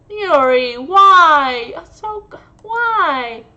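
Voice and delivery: loud stunned cry of why; pleading loud disbelief; shocked disbelief. Real, not acted. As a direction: call out her name in shock. Reacting to character's death.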